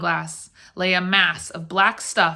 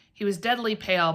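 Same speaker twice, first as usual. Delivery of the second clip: ordinary delivery, nothing marked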